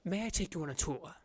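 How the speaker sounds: a hushed courteous question; soft tour guide. Acted. Frog guide offering the party a tour, softly. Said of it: "Dira speaks to you".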